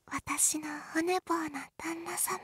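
slow, breathy whisper in his ear. Acted. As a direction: lean in and whisper slowly. Whispering to wake her fiancé.

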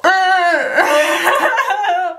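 high laugh through the sting of peeling; pained laugh, high. Real, not acted. Peeling latex off her face, a pained high laugh. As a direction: laugh it off while it hurts.

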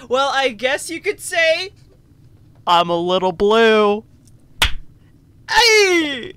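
loud mock-dramatic pun; hammed-up pun. Reading the character's pun to the player, loud and hammed-up. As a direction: belt the joke out. Reads pun line loudly.

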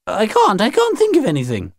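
high-pitched protest; high, insistent refusal; protesting alien aunt. Acted.

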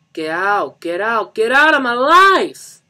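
escalating high, loud screams; furious scream. Acted. Johnny screams after Lisa as she leaves, enraged. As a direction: build to a drawn-out full scream. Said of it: "he screamed after her".

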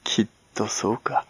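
soft, sneering check; mocking. Servant pins the old woman down, quietly sneering. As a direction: press her with quiet scorn. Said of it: "あざけるような声で念を押した。".

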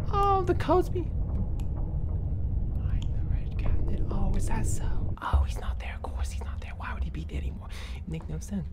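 tense horror whisper; scared whisper. Real, not acted. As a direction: whisper so the monster won't hear. Hiding from the monster.

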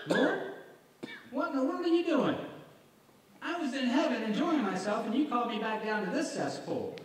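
high, loud, indignant complaint; mock indignant Lazarus. Lazarus complains to Jesus about being called back, high and indignant. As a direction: whine at Jesus like a dragged-back soul.

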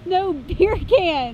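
loud, drawn-out mock English accent. Shown as a demonstration. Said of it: "In an English accent".

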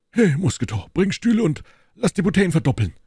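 loud, high, fast order; hearty host summoning his servant; calling a servant. Acted. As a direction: shout brisk orders to your valet.

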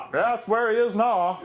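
Pennsylvania Dutch shout. Retold or imitated. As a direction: shout it at the new guy in a thick Pennsylvania Dutch accent. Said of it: "they talk funny and they shout".

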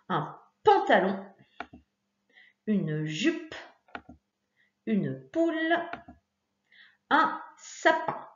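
put-on spaced out, each word set apart for a child; teacher giving the answers at a slow, even pace; slow answer list. Shown as a demonstration. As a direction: read the answers one by one, clearly. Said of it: "je te donne la solution".

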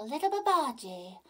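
put-on storybook tiger threatening a boy. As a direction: loom over him like a hungry tiger. Tiger threatens little Babaji.

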